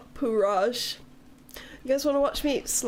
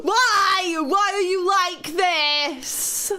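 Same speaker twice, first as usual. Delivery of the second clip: loud, high cry of mock despair, drawn out; exasperated wail; 5 semitones higher, 4 dB louder, much slower than usual. Real, not acted. Streamer teased by her chat, exasperated loud wail. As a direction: wail it at your tormentors, stretching the words.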